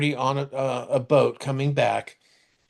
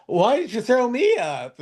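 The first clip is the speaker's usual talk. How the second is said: high-pitched imitation of a baffled complainer; retold indignant question, pitched way up; mock indignant protest; 10 semitones higher than usual. Retold man protesting being thrown up.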